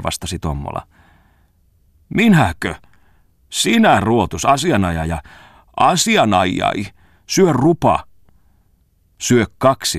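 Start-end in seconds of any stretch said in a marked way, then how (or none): 2.1-8.0 high, loud outburst of insults; indignant quarrel. A narrator's reading. Bongman abuses the young lawyer in an indignant outburst. 9.2-10.0 high, loud escalating retort; heated comeback. A narrator's reading. Tommola gets carried away, a heated comeback to Bongman. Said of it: "yltyi Tommola".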